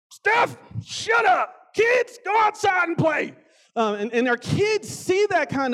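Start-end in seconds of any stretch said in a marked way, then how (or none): 0.1-3.3 high, slow yelled order; mock shout. Dad home with ten beers yelling at family. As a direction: bark at the wife and kids, hammed up. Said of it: "I'm like".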